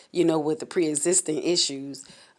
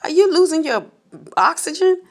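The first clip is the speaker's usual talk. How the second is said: high, loud alarmed friend; a friend asking in sudden alarm; imitating a worried friend, high and loud; 12 semitones higher, 6 dB louder than usual. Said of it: "She was like".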